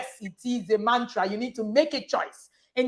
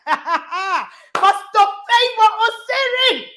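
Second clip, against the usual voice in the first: laughing; 7 semitones higher, 3 dB louder, slower than usual. Real, not acted. Laughs while praising the pastor.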